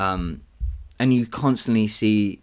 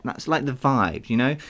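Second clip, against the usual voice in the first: normal talking voice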